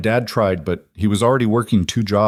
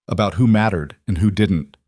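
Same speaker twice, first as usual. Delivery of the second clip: plain reading voice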